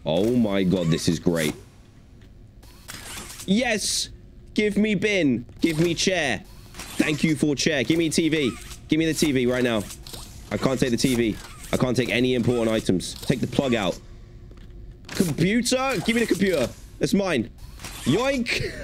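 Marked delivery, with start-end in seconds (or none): 3.4-9.8 greedy glee. Pretend. Greedily grabbing objects with the pack. 15.1-18.7 greedy glee. Pretend. Grabbing the computer playfully.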